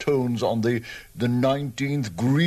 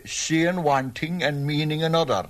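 ordinary talk

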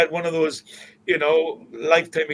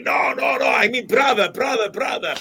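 correcting call; 11 semitones higher, 6 dB louder than usual. Retold or imitated. Angel correcting Chico's English.

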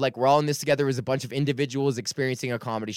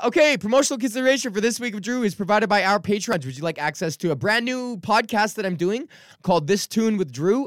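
higher, brighter announcer read; 7 semitones higher than usual. Acted. Host plugging his Patreon to listeners, announcer style. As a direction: sell it like a sponsor plug.

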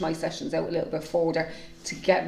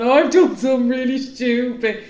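a loud, higher, hammed-up wail of guilt; dramatic confession; 4 semitones higher, 8 dB louder than usual. To her family at dinner, a loud, hammed-up confession. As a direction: play it like she's confessing a murder. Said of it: "I'm there going".

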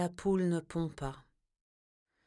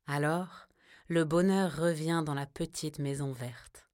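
ordinary delivery

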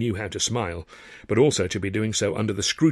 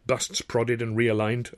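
usual speaking voice

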